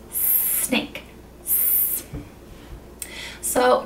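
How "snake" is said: whispered snake with a long S hiss. Shown as a demonstration. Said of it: "or this".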